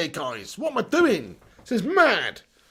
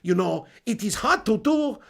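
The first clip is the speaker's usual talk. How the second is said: attempted Nigerian accent, evenly stressed syllables; careful, amused try at Nigerian English. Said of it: "This is my attempt at a Nigerian accent".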